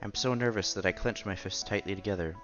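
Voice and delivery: ordinary delivery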